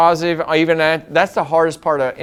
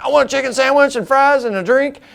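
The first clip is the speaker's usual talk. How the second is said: higher, curt mock-grumpy drive-thru order; retold grumpy order; 11 semitones higher than usual. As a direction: bark the order like you're in a foul mood. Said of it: "I was mad, mad, mad about something".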